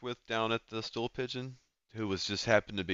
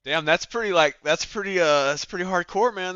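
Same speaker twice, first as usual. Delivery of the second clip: impressed excitement; 10 semitones higher, 8 dB louder than usual. Real, not acted. Player reacts to dark plot turn.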